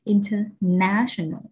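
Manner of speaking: international said slowly, NA stressed; international, slow with a strong third syllable; stress before the ending. Shown as a demonstration. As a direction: draw out international, leaning on NA. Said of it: "ngay đứng đằng trước nó".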